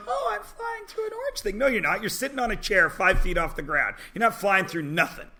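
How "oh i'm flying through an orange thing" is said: high, breathy-soft mock wonder; awed rider. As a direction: play the dazzled tourist floating through mist. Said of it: "they're like".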